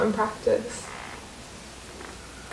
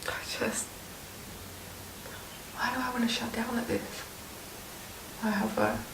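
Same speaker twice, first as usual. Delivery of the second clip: whispering; 12 dB quieter, faster than usual. Real, not acted. Overwhelmed during acting exercise.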